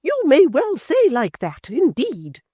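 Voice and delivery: high, loud, slow scornful echo; mocking high indignation; withering repeat. A narrator's reading. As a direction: let each repeat wither her.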